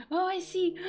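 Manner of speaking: very high, loud squeals between gasps; excited squealing. Real, not acted. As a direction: gasp and squeal as if overcome at the sight. Gasping excitedly at what loaded.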